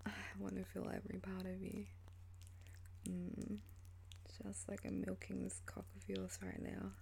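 usual speaking voice

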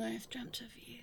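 soft whispered confession. Acted. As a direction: murmur it as a secret. Intimate confession before kiss.

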